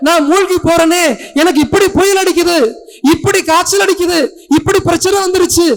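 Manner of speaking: high-pitched, loud, frantic complaint to God; desperate accusing protest. Acted. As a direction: plead and accuse as if drowning, building to a cry. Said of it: "கடவுளையே கேள்வி கேட்கிற அளவுக்கு". (கடவுளிடம் பதறிக் கதறி முறையிடும் குரல்)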